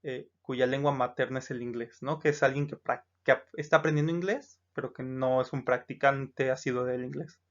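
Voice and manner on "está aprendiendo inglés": usual speaking voice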